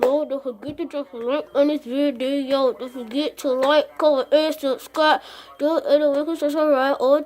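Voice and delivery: muffled through taped lips; mouth taped shut. Real, not acted. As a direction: talk with tape over your mouth. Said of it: "I gotta put some tape on my mouth".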